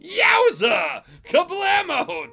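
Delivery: high, loud cartoon yell, stretched slow; hammed-up explosion shout. Reading fanfic aloud, yelling the explosion in mock glee. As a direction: belt out the boom like a comic book.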